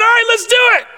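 very high excited shout, hammed-up; over-excited convert. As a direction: shout it like an overeager new believer. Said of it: "and your excitement".

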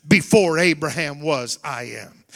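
booming preacher's proclamation; preaching at full force. Real, not acted. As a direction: proclaim it to the congregation. Preaching Jesus' words.

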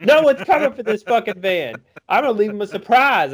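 high, loud, rapid mock tantrum; defiant little boy. Boy defies the older kids, loud hammed-up defiance. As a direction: crow it like a kid who won't be stopped. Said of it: "seven or eight".

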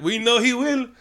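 hammed-up Latverian villagers in a Latvian accent. Podcaster voicing Doom's loyal subjects, mock Latvian accent. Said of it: "Nice Latvian accent".

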